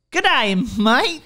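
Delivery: hammed-up Australian G'day; a cartoon Australian greeting; Australian accent. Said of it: "That was Australian".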